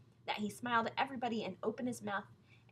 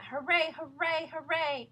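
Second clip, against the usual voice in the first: louder crowd cheer; 6 semitones higher, 6 dB louder, slower than usual. A narrator's reading. Audience cheering the sharpshooting lion. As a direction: cheer him on. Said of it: "the people went".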